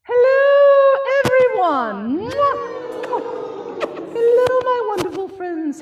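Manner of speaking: loud, high cheery hello; excited greeting. Real, not acted. Host greets children cheerfully.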